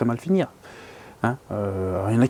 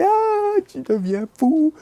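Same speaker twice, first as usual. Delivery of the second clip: panique moquée; much slower than usual. Retold or imitated. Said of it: "On comprend plus rien".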